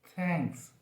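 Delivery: थैंक्स with the tongue tip at the teeth, breathy th; soft th. Shown as a demonstration. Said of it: "friction से आवाज़".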